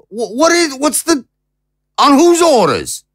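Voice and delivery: arrested and outraged; stunned outrage. Acted. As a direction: splutter it in disbelief. Being placed under arrest.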